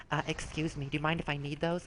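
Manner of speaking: very soft, meek request; hushed, hesitant politeness; timid player. Retold or imitated. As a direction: ask shyly in a small quiet voice. Voices timid trial-account player.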